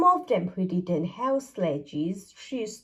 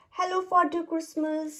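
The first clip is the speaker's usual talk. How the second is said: cheerful snowman voice; 7 semitones higher than usual. A narrator's reading. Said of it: "said Mister Snow".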